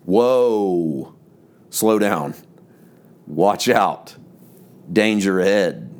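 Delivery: a drawn-out "Whoa" like reining in a horse; whoa, slow down. Shown as a demonstration. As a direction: pull them up short with "Whoa". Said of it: "I like to think of it as W O A H".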